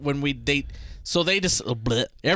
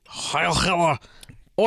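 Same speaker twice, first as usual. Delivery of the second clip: rough imitation of a German accent; a light German accent, lightly played; 4 semitones higher than usual. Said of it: "I don't know if I can do a German accent".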